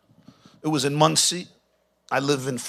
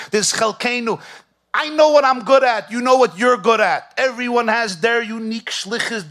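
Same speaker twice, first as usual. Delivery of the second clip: higher and louder, building preaching voice; rising sermon; 7 semitones higher, 4 dB louder than usual. Acted. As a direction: preach it out with growing fervor. Preaching rises in force.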